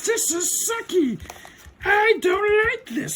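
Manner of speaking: very high, drawn-out cartoon sulk; Rita. Villain sulking over her defeat, high hammed-up whine.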